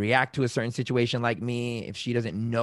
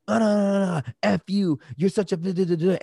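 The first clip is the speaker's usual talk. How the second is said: higher, louder mock rant at a partner; mock angry rant; 7 semitones higher, 4 dB louder than usual. Partner blowing up in an argument, mock angry rant. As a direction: play the partner blowing up, a bit hammed. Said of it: "So if they're like".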